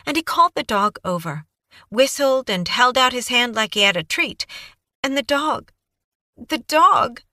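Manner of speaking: put-on high, breathless young woman babbling on; Kaylee's eager voice. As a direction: gush it out like a nervous, eager witness. Kaylee recounting the dog.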